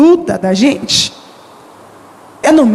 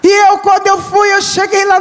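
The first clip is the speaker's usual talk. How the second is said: raised, shouting sermon voice; fervent preaching; 7 semitones higher than usual. Acted. Preacher testifying to the church, shouting with joy. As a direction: preach it with full force.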